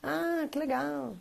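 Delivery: slow, soft, indifferent; mock mum barely reacting; unimpressed mother.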